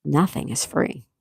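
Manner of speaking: slow, word-by-word emphasis; slow and deliberate. Real, not acted. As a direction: spell it out slowly for the listener. Spelling it out slowly after question.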